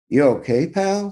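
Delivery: a retired cop checking on a friend; Farmer asking. A narrator's reading. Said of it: "Farmer says".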